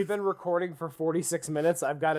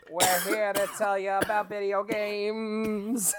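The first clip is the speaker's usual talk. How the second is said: hammy announcer voice; 5 semitones higher, slower than usual. Pretend. Mock intro announcement.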